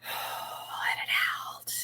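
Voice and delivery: whispered, slow exhale of the words; breathy release. Shown as a demonstration. Coach guiding viewers through a deep breath, whispered release. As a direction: sigh it out in a whisper. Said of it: "just take a deep breath".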